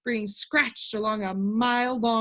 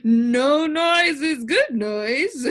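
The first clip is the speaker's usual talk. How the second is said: higher, louder, slow and laughing; happy exclamation; 4 semitones higher, 6 dB louder, much slower than usual. A narrator's reading. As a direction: join in the joke, laughing. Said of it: "exclaimed the humbug, happily".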